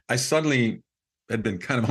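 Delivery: ordinary talk, nothing marked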